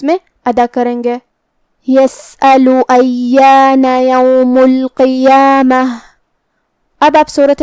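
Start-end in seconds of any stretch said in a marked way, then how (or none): 1.9-5.8 slow, chanted tajweed recitation; Quran recitation. Acted. Teacher reciting verses for the students. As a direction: recite the verses slowly and melodically.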